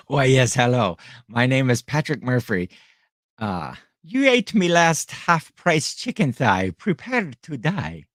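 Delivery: mock Irish avenger with a lilting brogue; playful Irish accent parody. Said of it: "I should have done that in an Irish accent".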